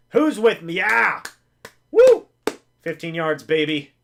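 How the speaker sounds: rally the viewers then whoop; ironic cheering. Real, not acted. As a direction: fan cheering a small win with mock joy. Mock-celebrating fifteen yards.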